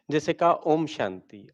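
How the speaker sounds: ordinary delivery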